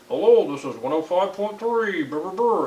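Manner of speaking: pinched, high radio announcer; fuzzy radio voice. Shown as a demonstration. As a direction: imitate a badly tuned radio DJ, squeezed. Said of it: "it sounds like somebody's pinched".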